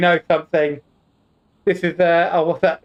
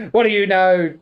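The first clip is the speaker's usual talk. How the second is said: ordinary talk, nothing marked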